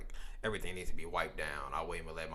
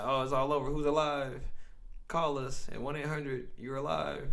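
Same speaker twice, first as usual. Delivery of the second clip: mock official announcement; 7 semitones higher than usual. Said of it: "then they gonna be like".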